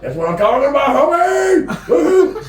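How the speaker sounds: loud, high whoop ending in laughter; rowdy celebratory shout; hyped-up cheer. Retold or imitated. Cheering the waiting limo in story.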